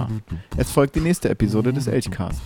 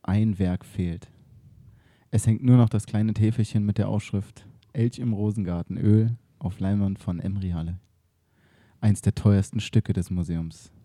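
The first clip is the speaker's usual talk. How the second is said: radio play narration; 4 semitones lower than usual. A narrator's reading. Narrator setting the museum scene, low and hushed.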